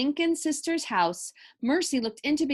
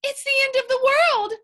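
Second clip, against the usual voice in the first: hysterical wail; 13 semitones higher, 8 dB louder, faster than usual. A narrator's reading. Wife panicking as bed falls.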